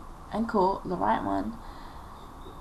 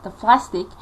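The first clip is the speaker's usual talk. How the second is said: ordinary talk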